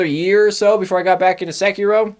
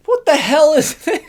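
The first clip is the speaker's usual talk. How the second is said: mock outraged disbelief; 9 semitones higher than usual. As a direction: recoil at a baffling new game. Retelling his reaction to Skyward Sword.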